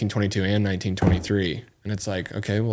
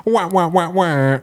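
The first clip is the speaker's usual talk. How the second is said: muffled wah wah talk; 9 semitones higher, 5 dB louder, much slower than usual. Pretend. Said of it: "maybe I should read it in a cinematic voice".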